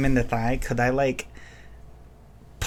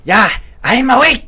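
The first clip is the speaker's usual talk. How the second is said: loud, raised in-character retort; defiant shout; 8 semitones higher, 8 dB louder than usual. Acted. Fighter answers the bandit she is stabbing, defiant shout. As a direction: yell it back at the enemy.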